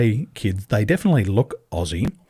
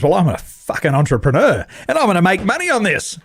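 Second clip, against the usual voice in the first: higher, cocky voice of a greedy businessman; mock brash entrepreneur; 8 semitones higher than usual. A man mocking a greedy businessman's cocky boast. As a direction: sneer it like a selfish hustler.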